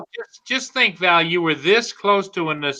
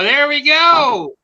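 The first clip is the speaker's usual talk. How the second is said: higher and slow; drawn-out high; 8 semitones higher, 5 dB louder, slower than usual. Shown as a demonstration. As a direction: stretch it out up high.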